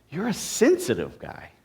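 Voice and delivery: SENSITIVE pushed above the other words; emphasis landing on "sensitive"; stress on SENSITIVE. Shown as a demonstration. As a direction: hit the word sensitive, let guy drop. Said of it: "you could emphasize any of those words".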